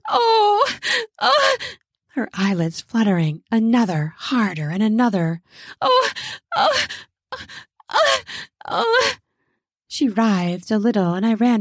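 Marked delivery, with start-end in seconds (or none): 0.1-1.6 high gasping moan; gasped moan. Acted. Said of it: "she gasped a moan". 5.8-9.1 string of high gasps; short high moans, one by one; gasped moans. Acted. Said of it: "she gasped a moan".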